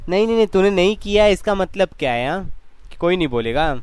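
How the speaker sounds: insistent scolding. Real, not acted. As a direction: push him to answer, voice up. Teacher pressing silent student to answer. (जवाब के लिए ज़ोर देती ऊँची आवाज़)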